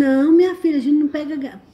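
exasperated scolding. Real, not acted. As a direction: scold your daughter out loud, exasperated. Mãe repreende a filha ao vivo. (bronca de mãe, em voz alta)

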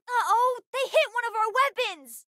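high-pitched urgent cry; alarmed battle callout. Acted. Child reporting a weapon hit to the crew, alarmed. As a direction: call out the damage in alarm.

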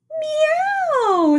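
high drawn-out mock meow; a playful high meow for a child; high cat meow. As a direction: stretch a high kitty meow. Cat's line in story.

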